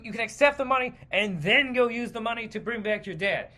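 normal talking voice

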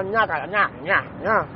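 mock chewing sounds like a goat; goat chewing noise. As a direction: smack it out like a gum-chewing goat. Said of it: "parece una cabra".

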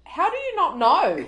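loud, high-pitched mock indignation; retold indignant challenge. Retelling Rob challenging the receptionist, in mock indignation. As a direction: act out his incredulous demand.

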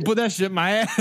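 laughing, hammed-up American patriot; a parody of a proud American, laughing; mock real American. Friends joking about thermometers, mock American patriot voice. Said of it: "like a real American".

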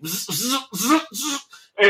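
buzzing bursts of electric shock; imitated electric zapping. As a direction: zap like a live wire.

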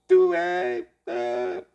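high, slow falsetto wail in French; haunt them in drawn-out French; spooky French ghost moan; ghost speaking French. Acted. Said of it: "lo dice en francés".

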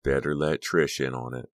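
usual speaking voice

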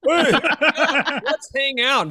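hammed-up fake buddy voice; mocking quote. Mocking Cody's empty promise to keep in touch, laughing mock-cheery.

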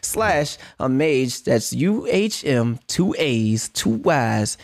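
big pitch like a radio host; energy drink ad. Acted. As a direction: hype radio announcer. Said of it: "It's, it's like you've done that before".

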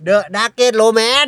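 drawn-out name. Shown as a demonstration. Spelling out a band name slowly.

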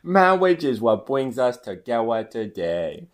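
slow, drawn-out pompous priest with mushy R's; lisping wedding officiant; comic priest. Acted. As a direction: intone it slowly with R's turned to W's. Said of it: "Oh, this is your line".

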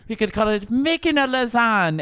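high, sing-song mock-Irish; Irish leprechaun accent. Proudly repeating the show title, hammed-up Irish leprechaun accent. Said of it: "that was a leprechaun accent".

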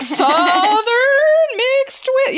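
high, very drawn-out guess; mock clueless guess. Friend guessing an accent very wrongly, drawn-out and clueless. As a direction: stretch the words out high, as if groping.

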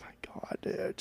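exasperated whisper. Real, not acted. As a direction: breathe it out, fed up. Friend won't accept the song.

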